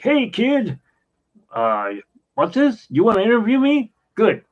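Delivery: high, loud, brash quoted voice; impersonating a brash, excitable interviewee; wild Disney old-timer. Retold or imitated. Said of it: "but he's wild".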